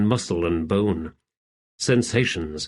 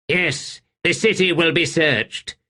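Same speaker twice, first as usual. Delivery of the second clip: brisk warrior; 7 semitones higher than usual. Acted. Middick voiced.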